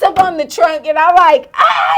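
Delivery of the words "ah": short, very high shriek; high retold scream of fright; mock scream. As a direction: yelp in acted-out terror. Retold scream atop the car.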